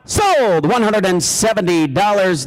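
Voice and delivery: loud, high shout of the final sale; sold call. Shown as a demonstration. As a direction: bang it home like the gavel falls. Closing the auction sale.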